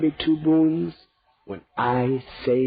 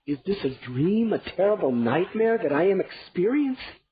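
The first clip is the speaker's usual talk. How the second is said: rising, higher horror; stunned disbelief; 7 semitones higher than usual. Acted. King to queen, horrified disbelief. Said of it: "He spoke".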